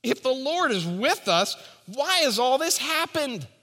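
high, indignant mock protest; Gideon's complaint. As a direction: play Gideon as a sulking complainer. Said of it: "what's Gideon's response?".

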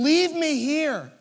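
Mary turning to Jesus, crying and begging to be left alone; imitating sobbing Mary. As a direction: cry out in devastation. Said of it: "crying, sobbing, just saying".

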